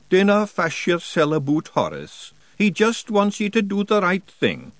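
high Scottish pixie brogue; Scottish Feegle voice. Acted. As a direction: daft cheery Scots voice. Daft Woolly speaking.

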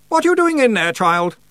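very high, loud, peevish aunt. A narrator's reading. Aunt calls to Roland through a locked door, peevish. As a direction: demand an answer in a high, fussy voice. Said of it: "said a muffled voice peevishly".

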